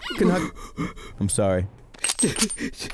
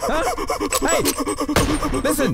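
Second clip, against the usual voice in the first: angry yelling; 13 semitones higher than usual. Acted. Boss yelling at the monkey worker, furious. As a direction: scream at a useless worker.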